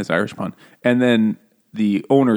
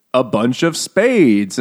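slow punchline; much slower than usual. Retold or imitated. Punchline of the racist joke.

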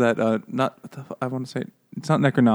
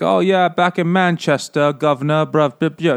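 hammed-up Manchester accent, higher and louder; a joking London-street bruv voice; mock English accent; 4 semitones higher, 3 dB louder than usual. Imitating the English character's Manchester accent. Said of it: "English, but she is".

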